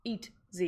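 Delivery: "eat Z" in two separate beats; Etsy as EAT-zee. Shown as a demonstration. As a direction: spell out the sound as "eat", then "Z". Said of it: "I say Etsy as in".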